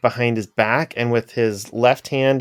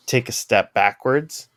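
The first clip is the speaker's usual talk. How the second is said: usual speaking voice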